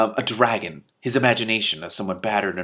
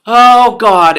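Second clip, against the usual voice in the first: much higher and far louder cry; gasping cry; 12 semitones higher, 17 dB louder than usual. Acted. Two Flower passing out high in the air, loud cry. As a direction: cry out as you faint.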